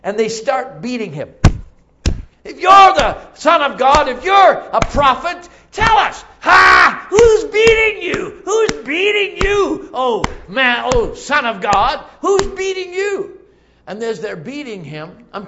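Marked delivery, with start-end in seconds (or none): 2.4-13.4 loud, high mocking shouts; bullying soldier's shouted goading; jeering taunt, acted. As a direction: bellow taunts at a helpless prisoner. Soldiers acted taunting blindfolded Jesus.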